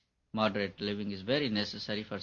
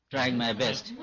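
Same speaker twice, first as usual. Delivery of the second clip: usual speaking voice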